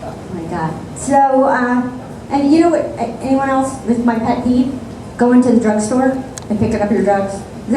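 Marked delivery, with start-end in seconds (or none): none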